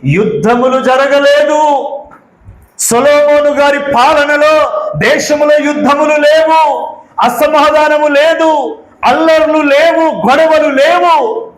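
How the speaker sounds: high, sustained preaching cadence; preacher's declamation. Acted. Declaims scripture and Solomon's reign.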